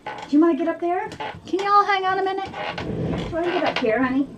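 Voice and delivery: high, fast coaxing voice; pet talk. Real, not acted. Owner inviting her dog to jump up, high quick coaxing.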